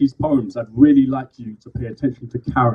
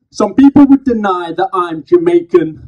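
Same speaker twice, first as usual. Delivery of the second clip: loud, higher, punchy slam poetry delivery; spoken word poem; 5 semitones higher, 8 dB louder, slower than usual. Acted. Poet performing to a live crowd, loud slam declamation. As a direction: declaim each phrase to the crowd.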